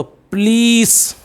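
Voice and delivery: पुलिस as "pleees", first vowel swallowed; long-vowel पुलिस. Shown as a demonstration. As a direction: stretch the "ee" in पुलिस. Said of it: "लीस को तो आपको बिल्कुल longer".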